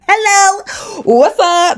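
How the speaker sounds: mock newly-engaged giddiness; giddy mock greeting. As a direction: flaunt the ring while saying hi. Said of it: "I'm gonna be like this all the time".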